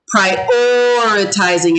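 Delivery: "Prioritizing" slowly, with a very long OR; lengthened O. Shown as a demonstration. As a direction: dwell on the "or" in "Prioritizing". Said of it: "It has a O sound".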